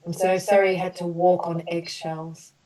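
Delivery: gentle, measured apology statements for the group; compassionate healing affirmations. Acted. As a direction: offer each sorry like a soothing balm. Leads group through healing apologies.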